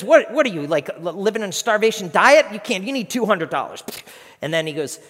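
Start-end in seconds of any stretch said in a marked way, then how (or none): 0.0-3.8 loud, raised incredulous scolding; gruff boss. Retold or imitated. Boss rejects employee's fifty-dollar estimate, gruffly incredulous. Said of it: "he's just gruff".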